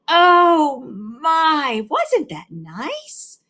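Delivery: high, loud, drawn-out coo; gushing teacher. A narrator's reading. Teacher cooing to the class after reading, high and slow. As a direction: gush over the story like a sugary teacher.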